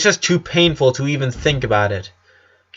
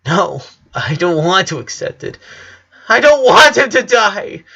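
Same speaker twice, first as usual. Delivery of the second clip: from tearful plea building to a high, loud scream; grieving girl refusing her sensei's advice, rising to a tearful scream; 7 semitones higher, 4 dB louder than usual. Acted. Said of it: "she screamed".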